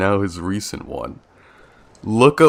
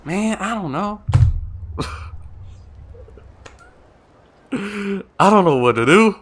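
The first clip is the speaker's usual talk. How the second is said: high-pitched, put-on whine of confusion; squeaky mock despair; comic helplessness; 12 semitones higher than usual. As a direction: pitch it way up, playfully lost. Comic helplessness over which voice.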